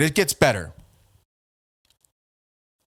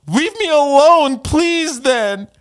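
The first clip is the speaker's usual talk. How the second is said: high, drawn-out, louder mocking voice; mock whiny rival; 13 semitones higher, 6 dB louder, much slower than usual. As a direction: mimic his pleading in a squealed falsetto. Mocking reading of his rival's DM.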